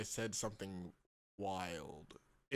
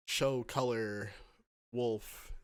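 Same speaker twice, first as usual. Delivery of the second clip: ordinary talk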